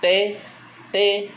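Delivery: T said very high and loud; rising high tone. Shown as a demonstration. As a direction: sing out T on a high rising Hmong tone. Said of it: "l'intonation qui monte". (T prononcé très haut et fort, ton montant)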